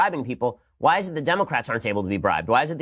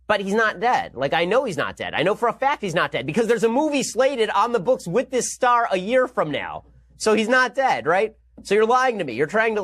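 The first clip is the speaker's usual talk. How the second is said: pitched way up, insisting he's not dead; exasperated disbelief; 7 semitones higher than usual. Retold or imitated. Moviegoer refusing to believe the hero died, exasperated and high. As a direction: protest that the death is fake, voice climbing. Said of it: "And you're like".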